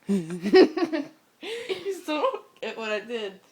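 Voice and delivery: laughing. Real, not acted. Laughing while explaining.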